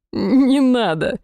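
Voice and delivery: slow, moaning plea; moaning through laughter. Acted. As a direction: moan it out, weak from laughing. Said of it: "простонал демон".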